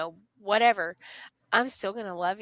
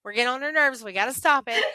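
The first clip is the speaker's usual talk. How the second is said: voicing the kids; 6 semitones higher, 4 dB louder than usual. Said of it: "And they know that".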